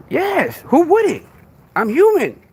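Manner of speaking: real high-pitched indignation; indignant self-defence. Real, not acted. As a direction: voice leaping high in protest. Defends getting upset at false accusations.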